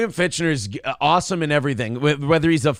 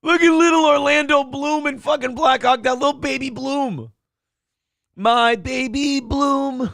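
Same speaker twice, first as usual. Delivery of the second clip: put-on gushing baby talk; 9 semitones higher, 3 dB louder than usual. Gushing over a little actor on screen, high cooing baby talk. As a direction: squeal like fawning over a baby.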